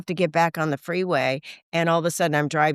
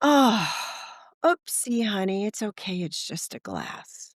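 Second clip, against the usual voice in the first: reassuring; 4 semitones higher, slower than usual. Retold or imitated. Wife reassuring herself over a dropped glass, tenderly. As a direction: comfort her like a small child. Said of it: "and I say".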